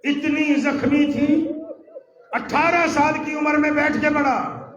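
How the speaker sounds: loud, high grieving lament; mourning father crying aloud; weeping cry. Acted. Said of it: "علی رو کے کہتا ہے".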